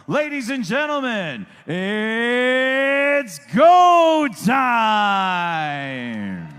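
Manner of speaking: big arena call, dragged out; drawn-out hype shout. Acted. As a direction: stretch it out, booming and slow. Ring announcer hypes the crowd.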